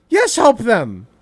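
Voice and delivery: normal talking voice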